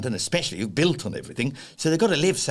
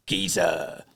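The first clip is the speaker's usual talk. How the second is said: tag; 8 semitones lower than usual. Acted. Posh fox tags on Cockney slang.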